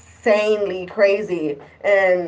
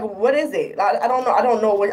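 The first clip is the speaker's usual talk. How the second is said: ordinary talk, nothing marked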